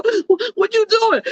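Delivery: high, startled question over the phone; mock alarmed question. To the distracted friend, a high startled what. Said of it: "you go".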